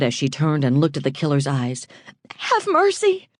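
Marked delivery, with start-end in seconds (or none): 2.4-3.3 much higher, begging woman's voice; pleading woman. A narrator's reading. Woman facing the gunman, begging for her life. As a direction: beg for your life.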